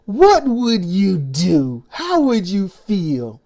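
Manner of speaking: slow, tearful pleading question; pleading little people. Retold or imitated. Little people asking politicians, slow tearful plea. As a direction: beg them, voice catching like a sob. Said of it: "We little people ask".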